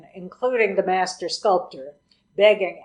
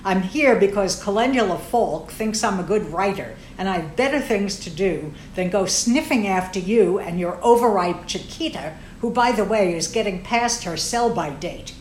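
ordinary delivery

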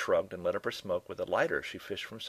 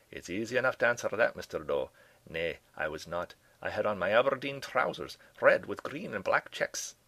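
relieved old Scot, voiced more gently; Scottish professor; 2 semitones higher, 3 dB louder than usual. Relieved professor answers the detective's kilt question, in a lightly voiced Scottish brogue.

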